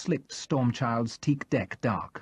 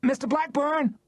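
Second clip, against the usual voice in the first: much higher small woman's shout; shouted name, stretched out. Acted. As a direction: call out from the back of the crowd. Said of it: "a small voice shouted".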